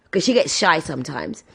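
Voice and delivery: ordinary talk, nothing marked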